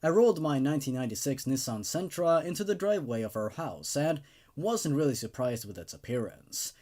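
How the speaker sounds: ordinary talk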